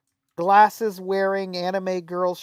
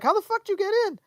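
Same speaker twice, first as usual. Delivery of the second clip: disbelief; 11 semitones higher, faster than usual. Retold or imitated. Friends stunned he got in.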